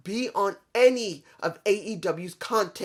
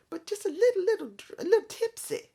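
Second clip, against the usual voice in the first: high, laughing mock tipsy voice; lightly hammed-up tipsy giggle; 13 semitones higher than usual. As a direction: play it a little tipsy with a laugh. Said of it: "not like this drunk".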